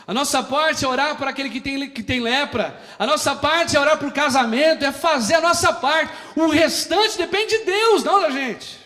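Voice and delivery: high, loud, driving sermon cadence; full-force preaching. Acted. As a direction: hammer each line to the congregation. Prega a plena força. (pregação inflamada, alta e aguda)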